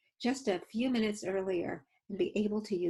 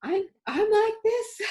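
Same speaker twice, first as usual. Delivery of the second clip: mock nervous; 12 semitones higher, 6 dB louder than usual. Shown as a demonstration. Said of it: "I'm worried".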